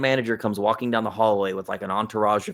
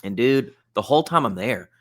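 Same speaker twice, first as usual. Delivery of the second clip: ordinary talk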